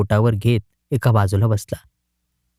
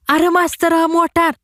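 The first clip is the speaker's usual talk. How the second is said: much higher alarmed cry; warning shout. A narrator's reading. Jagu warns his friend about the car, shouting high. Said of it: "जगू ओरडला".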